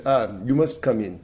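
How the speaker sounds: put-on light Israeli accent, inviting; mild Israeli accent impression; Israeli accent imitation. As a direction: do your Israeli friend asking you inside. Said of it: "and he talked like this".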